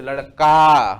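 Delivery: लड़का with the final का loud and drawn out; long, loud "aa" closing लड़का; stressed final आ. Shown as a demonstration. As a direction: stretch and boom the "का" ending of लड़का. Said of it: "आ का बोध हो रहा है इसमें".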